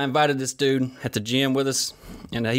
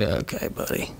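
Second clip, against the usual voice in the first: humoring; 2 semitones lower, 4 dB quieter, much slower than usual. Retold or imitated. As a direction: go along with the kid gently. Dad humoring son.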